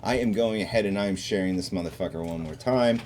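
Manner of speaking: ordinary delivery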